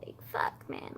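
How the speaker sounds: soft breathless whisper; whispered aside. Real, not acted. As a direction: whisper it like a secret. Whispered aside about crush.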